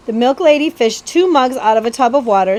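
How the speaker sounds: plain reading voice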